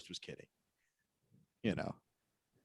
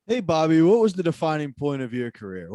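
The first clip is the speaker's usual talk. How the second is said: mock interviewer; 3 semitones higher, 6 dB louder than usual. Mock interviewer asking Bobby.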